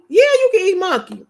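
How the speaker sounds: excited answer. Real, not acted. Wife answering a viewer that monkey can be eaten, emphatic. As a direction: declare it with relish.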